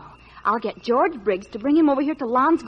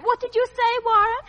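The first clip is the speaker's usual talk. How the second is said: shouting down the phone; 9 semitones higher than usual. Real, not acted. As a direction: shout each word so it carries down the line. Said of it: "The whole neighborhood can hear you".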